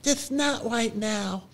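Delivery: Romney. Retold or imitated. Small son reassuring his dad in the car, little-kid voice. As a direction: answer as a three-year-old would, drawn out. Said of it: "And he goes".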